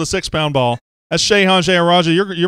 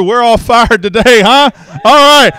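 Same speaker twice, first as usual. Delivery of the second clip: laughing; 7 semitones higher, 10 dB louder, slower than usual. Real, not acted. Laughing about being fired.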